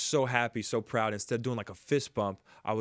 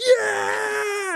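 high loud victory yell; high celebratory cry; triumphant shout; 9 dB louder than usual. Retold or imitated. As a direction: scream it out in triumph. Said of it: "running around the base like".

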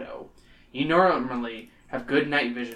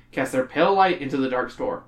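ordinary talk, nothing marked

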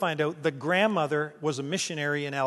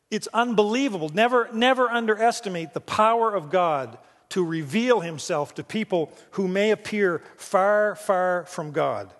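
rising preaching; 3 semitones higher than usual. Real, not acted. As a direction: drive the point home to the congregation. Preacher building to exhortation.

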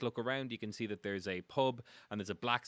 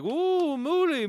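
very high, loud adoring whoop; crowd cheering; 10 dB louder, much slower than usual. Acted. Game master as the crowd greeting the dog, cheering. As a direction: whoop for the festival's favourite. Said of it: "everyone's like".

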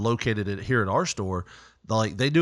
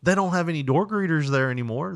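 ordinary delivery

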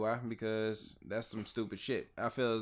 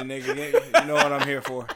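louder, laughing through the words; laughing loudly; 3 semitones higher, 12 dB louder than usual. Real, not acted. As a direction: cackle it out at your own weakness. Laughing about breaking his fast.

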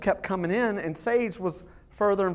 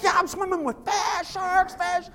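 put-on very high, excited kid voice; imitating excited kid; 11 semitones higher than usual. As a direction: squeal it like a thrilled child. Imitating excited son swimming.

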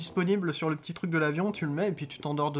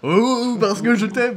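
put-on American accent; 6 semitones higher, 9 dB louder, much slower than usual. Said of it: "avec un accent américain".

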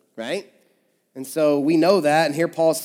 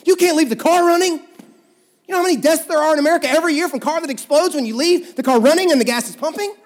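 high-pitched, loud worrier's rant; mock panic, voice pushed up high; freaking out; 10 semitones higher, 5 dB louder than usual. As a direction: act out a panicking friend's alarmed scolding. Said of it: "like, freaking out".

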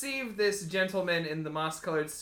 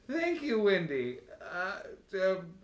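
eccentric billionaire; 4 semitones higher, slower than usual. Acted. Eccentric billionaire thanks assistant.

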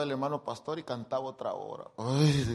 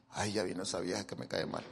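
grumbling aside; 6 semitones lower, 7 dB quieter, faster than usual. Retold or imitated. Grumbling aside after opening eyes.

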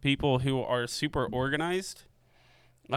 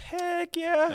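excited. Real, not acted. Cheering for the Nikon segment.

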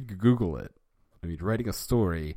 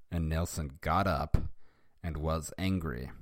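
ordinary delivery, nothing marked

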